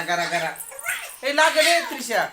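ordinary delivery

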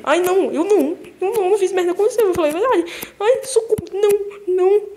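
high, loud frantic wail; panicked pleading. Real, not acted. Player begging the attacking enemy to stop, panicked cry. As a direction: beg for help in a rising panic.

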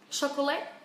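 "Chocolate" as "so-co-LET", rising at the end; three-syllable "Chocolate" lifted on the last; common mispronunciation. Shown as a demonstration. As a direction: say "Chocolate" wrongly, going up on "let". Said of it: "các bạn hay phát âm sai".

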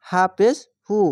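ordinary talk, nothing marked

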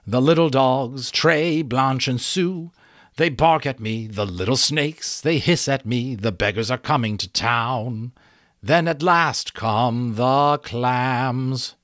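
butterfly chanting rhymes; butterfly singsong. A narrator's reading. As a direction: chant the nonsense playfully. Butterfly singing nonsense.

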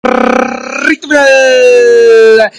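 long, stretched call; drawn-out announcement. Real, not acted. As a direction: stretch the words slowly. Announcing the home straight. (anuncio estirado y lento)

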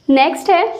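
normal talking voice

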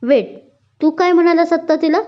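high, thrilled questioning; almost squeal with joyful disbelief; excited, voice lifted as the news sinks in; delighted near-shout. A narrator's reading. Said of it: "ती जवळजवळ ओरडलीच".

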